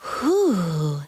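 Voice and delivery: put-on eager, childlike question; childlike excitement. Mantis asking eagerly about the mystery figure, childlike excitement. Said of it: "Mantis wondered with childlike excitement".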